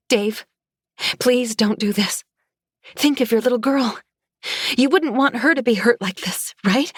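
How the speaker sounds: high, desperate plea; frightened pleading. A narrator's reading. Pleading with attacker to stop.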